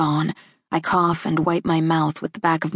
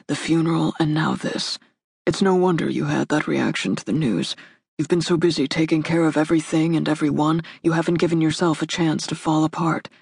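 gentle male voice. A narrator's reading. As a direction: comfort her tenderly. Man comforts grieving woman.

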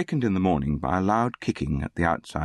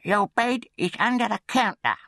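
bossy undertaker's wife. A narrator's reading. Undertaker's wife leads the boy upstairs, high bossy command. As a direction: order the boy along in a high voice.

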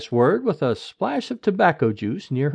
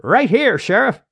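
raised, higher answering call; calling back; 8 semitones higher, 6 dB louder than usual. A narrator's reading. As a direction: call out to the boss. Deputy calls back to the sheriff.

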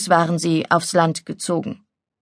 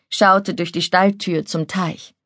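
ordinary delivery

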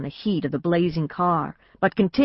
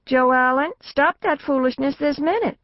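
a hint of a firm mother, raised pitch; mother directing; 8 semitones higher than usual. A narrator's reading. Mother scolding son from the car, lightly firm. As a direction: scold him to stop, lightly voiced. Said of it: "Mama directed".

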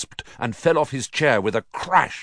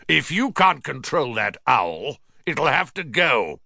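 raised-pitch angry warning; roar; 5 semitones higher than usual. A narrator's reading. Uncle threatening his nephew over a noisy owl, roaring. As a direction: warn him in an angry uncle's voice. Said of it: "he roared across the table".

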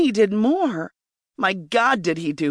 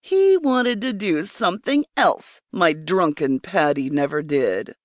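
usual speaking voice

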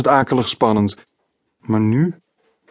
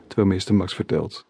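ordinary delivery

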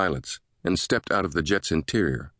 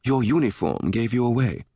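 higher, teasing British lilt; playful British ribbing, pitched up; playful British woman; 5 semitones higher than usual. A narrator's reading. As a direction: tease him in a sing-song British voice. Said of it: "She playfully motioned to his clothing".

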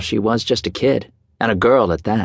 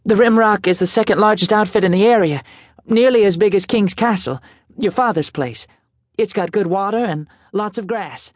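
higher, hurried nervous pitch; racing nervously; 10 semitones higher than usual. A narrator's reading. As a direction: rush it out before losing nerve. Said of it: "racing to get everything out".

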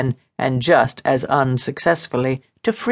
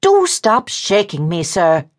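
higher, indignant woman's protest; cross woman; 6 semitones higher than usual. Acted. Woman objects to being shaken, mildly cross. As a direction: protest with a touch of temper. Her temper rose.

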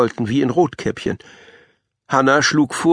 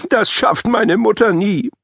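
put-on much higher whining child; whiny kid; 8 semitones higher than usual. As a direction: whine it like a grumpy pupil. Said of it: "quengelte Mika".

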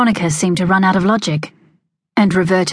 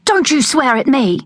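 high, sharp rebuke; indignant scolding; 8 semitones higher than usual. A narrator's reading. Veronica scolding Jack for swearing, indignant. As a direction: scold him hard, finger raised.

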